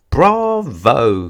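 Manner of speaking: loud, high delighted cheer; cheering bravo. Acted. Teacher cheering her pupil's pirouette, loud delighted bravo. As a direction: shout it out like the final curtain call. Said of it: "cried little Miss Somersault".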